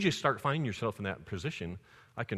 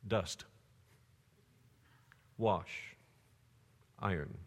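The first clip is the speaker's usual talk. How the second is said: each word dropped alone, softer and softer; dust, wash, iron spaced out and fading; punchline pauses; 3 semitones lower, 6 dB quieter, much slower than usual. Shown as a demonstration. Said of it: "punchline delivered slowly".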